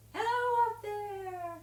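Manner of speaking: high, loud, drawn-out call; slow falsetto greeting. Shown as a demonstration. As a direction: call up to someone very tall. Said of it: "so you go".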